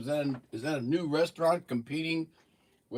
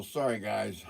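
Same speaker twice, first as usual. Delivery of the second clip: ordinary talk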